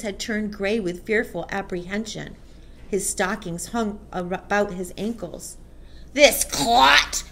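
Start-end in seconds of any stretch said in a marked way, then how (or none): 6.1-7.2 loud, raised, contemptuous boom; booming accusation. A narrator's reading. Headmistress points out the culprit to pupils, booming. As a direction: boom the insult to a hall. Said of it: "boomed the headmistress".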